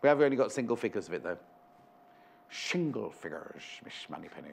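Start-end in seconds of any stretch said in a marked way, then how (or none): 2.5-4.5 low, quiet Scottish slur, "Shingle" with a sh; Sean Connery impression. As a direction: Sean Connery's Scottish lisp, lightly done. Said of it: "That's my, um, Sean Connery impression".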